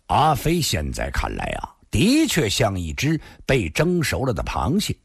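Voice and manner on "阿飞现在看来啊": usual speaking voice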